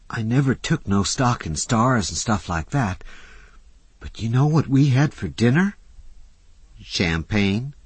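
put-on a young woman thrilled to tell her friend about dinner; excited Susie. A narrator's reading. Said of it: "eyes were shining with excitement".

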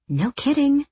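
dry high sarcastic jab. Acted. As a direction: throw it back at her mockingly. Sister's sarcastic reply.

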